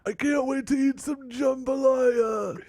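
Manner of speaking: put-on very high, slow New Orleans caricature accent; mock American accent. Student shows professor his hammed-up New Orleans accent. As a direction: pitch way up, drawl a silly Southern American accent. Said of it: "Give me your best American accent".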